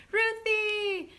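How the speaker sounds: high, loud drawn-out call. Real, not acted. Interrupting the story to call Ruthie, high call. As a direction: call the name in a sing-out pitch.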